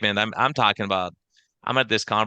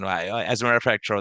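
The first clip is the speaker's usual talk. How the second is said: usual speaking voice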